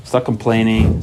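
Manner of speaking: usual speaking voice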